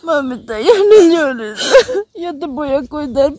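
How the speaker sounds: crying loudly. Acted. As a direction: sob through the comfort. Daughter sobbing while comforting mother.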